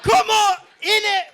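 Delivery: high, loud mock Queen egging someone on; shouting Queen. Comic acting the Queen urging her people on, shrill mock shouting. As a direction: belt it out as a hammed-up, high-pitched Queen. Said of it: "'cause the Queen's like".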